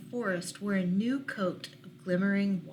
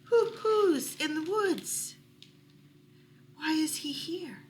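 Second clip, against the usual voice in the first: questioning owl; 6 semitones higher, 3 dB louder than usual. Acted. Owl of many questions asking why the stranger came, high hooting. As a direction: hoot out the worry. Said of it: "asked the owl of many questions".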